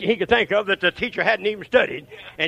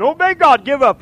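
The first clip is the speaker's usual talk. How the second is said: mimicking an elder's loud, high call; raised, insistent altar call; urgent exhortation; 10 semitones higher, 12 dB louder than usual. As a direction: holler it like an exhorting church elder. Acts out an elder urging her.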